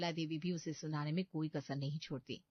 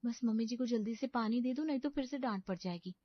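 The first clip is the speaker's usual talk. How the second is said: anxious inner thought; 5 semitones higher than usual. Acted. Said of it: "मन में सोचती है".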